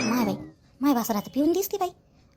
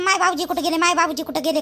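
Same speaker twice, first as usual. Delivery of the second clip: usual speaking voice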